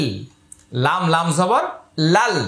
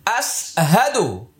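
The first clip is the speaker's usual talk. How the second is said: slow recitation; 2 semitones higher, slower than usual. Shown as a demonstration. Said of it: "আবার আয়না ধরুন".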